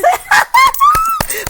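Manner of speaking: a high, loud burst of laughter; loud delighted laugh. Real, not acted. Host laughing loudly while listing the countries watching. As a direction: let out a big joyful laugh.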